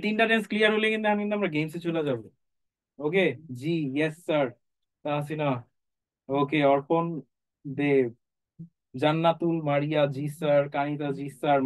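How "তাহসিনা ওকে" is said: ordinary delivery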